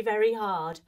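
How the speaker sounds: plain reading voice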